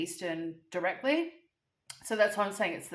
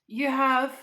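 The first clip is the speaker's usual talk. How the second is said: higher and louder, gushing thanks; grateful thanks; 5 semitones higher, 7 dB louder, slower than usual. Retold or imitated. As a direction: thank her like she saved your day. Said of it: "And I'm just like".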